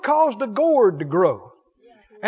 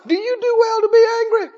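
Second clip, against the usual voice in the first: God questioning; 9 semitones higher than usual. Acted. Preacher voicing God's question.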